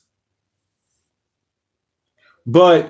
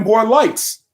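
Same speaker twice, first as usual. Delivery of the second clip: normal talking voice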